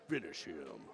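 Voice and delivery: low, dark arcade announcer growl; Mortal Kombat announcer. Retold or imitated. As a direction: drop the voice like a fighting-game boss call. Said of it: "the Mortal Kombat voice".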